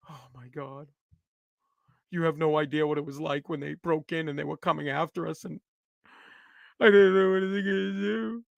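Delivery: soft, low mock-victim voice; hushed, put-on trauma; Adam Schiff. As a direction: imitate a shaken survivor, very quietly. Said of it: "He's another one that's".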